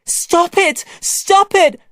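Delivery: very high, loud scream; boy screaming at his fighting family. Acted. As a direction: scream at them to stop. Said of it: "I screamed".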